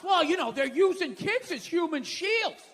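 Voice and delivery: a defensive spokesman brushing it off; mock official excuse. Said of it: "This is my favorite response".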